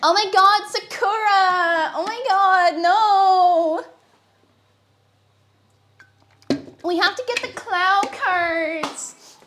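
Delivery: high, loud, drawn-out wailing dub voice; bad anime dub. Parodying a Sakura dub, high, loud and slow wail. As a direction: overact a panicked anime heroine. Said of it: "bad anime dubs".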